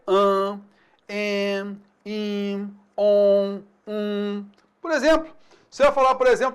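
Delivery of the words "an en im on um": "an, en, im, on, um" held long through the nose; sustained nasal vowel demonstration. Shown as a demonstration. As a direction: hum each nasal vowel on a level pitch, pausing between. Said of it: "O som da vogal nasal é".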